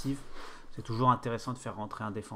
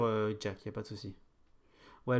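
usual speaking voice

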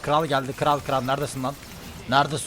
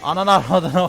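louder and higher, a burst of a curse; excited cursing shout; 6 semitones higher, 10 dB louder than usual. Real, not acted. Streamer cursing in triumph after the kill, loud excited shout. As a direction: blurt it out at full volume.

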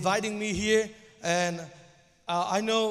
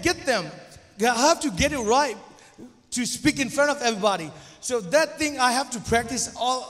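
usual speaking voice

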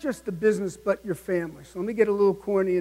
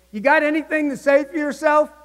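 higher, louder mocking boss challenge; smug boss; 6 semitones higher, 3 dB louder than usual. Movie boss mocks Harry, higher and louder smug challenge. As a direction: needle him like a smug boss. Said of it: "he goes".

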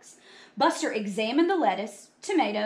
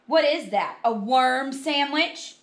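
loud, raised, drawn-out question; mocking disgust; 4 semitones higher, 6 dB louder, slower than usual. Buster pointing at Carla's lunch, mocking disgust. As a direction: jeer at a revolting lunch.

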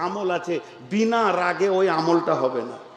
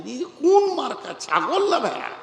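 mocking ridicule; 9 semitones higher than usual. Mocking beardless young men.

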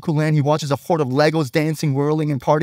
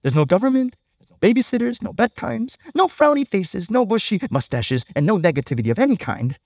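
bright, higher cartoon princess voice; cheerful Unikitty; 6 semitones higher than usual. Preacher quoting the cartoon: cheerful high Unikitty voice. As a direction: cheerfully list everything banned, much higher. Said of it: "Unikitty cheerfully explains".

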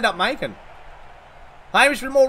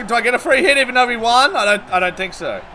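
usual speaking voice

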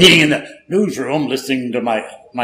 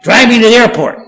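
urgent demand; 8 semitones higher, 6 dB louder than usual. Retold or imitated. Urgent demand to his date.

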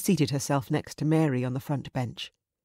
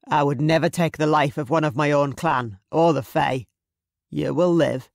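louder, commanding Scottish raider; imposing clansman; 5 dB louder than usual. Acted. Raider studying the captive girl, imposing voice. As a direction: play the imposing villain sizing someone up. Villain answers her plea.

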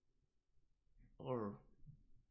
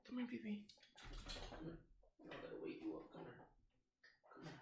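hushed, much softer coaxing voice; 3 semitones lower, 19 dB quieter, faster than usual. Real, not acted. Streamer softly coaxing his dog to sleep on the cushion, hushed. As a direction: gently coax the dog to lie down.

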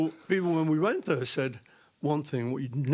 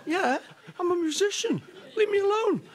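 high put-on voice, hammed-up plea; mock pleading. Singer echoing his wife's plea to the muggers, hammed-up. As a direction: send up your own feeble plea. Mocking their own plea.

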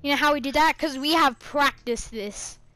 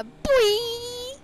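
hyped shout; 10 semitones higher than usual. Real, not acted. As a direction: squeal it out in hype. Hyped exclamation to friend.

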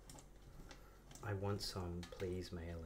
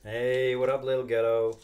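big shouted greeting, higher pitched; loud hail; 4 semitones higher, 14 dB louder, slower than usual. Real, not acted. Streamer welcoming a viewer to the stream, shouted. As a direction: call out much louder to greet.

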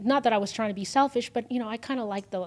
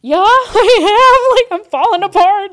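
much higher, loud, laughing through it; a laughing, cracking confession; laughing breakdown; 12 semitones higher, 19 dB louder than usual. Retold or imitated. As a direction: laugh while admitting you're falling apart. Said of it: "I'm like".

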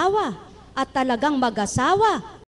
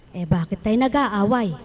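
normal talking voice

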